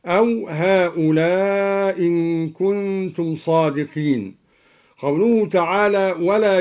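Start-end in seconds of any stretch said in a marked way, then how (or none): 0.5-4.3 "هؤلاء إن" with one hamza after a long drawn "lā"; hamza dropped, prolonged. Shown as a demonstration. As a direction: stretch the end of "هؤلاء" long, then only one hamza into "إن". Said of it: "على القصر والمد". (إسقاط الهمزة في "هؤلاء إن" مع المد)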